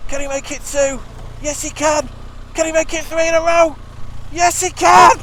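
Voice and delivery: high, loud excited commentator building to a shout; hammed-up commentator going up and up to a cheer; mock sports commentary. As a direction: call it like a match winner. Commentates his own hat trick.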